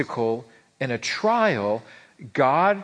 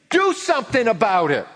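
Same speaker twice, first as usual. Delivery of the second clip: louder, higher, demanding whine; mock angry grumbling; 6 semitones higher, 4 dB louder than usual. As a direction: turn on the leader in a hammed-up huff. Israelites turn on Moses.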